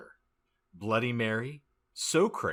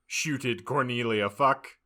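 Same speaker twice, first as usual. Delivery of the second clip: ordinary delivery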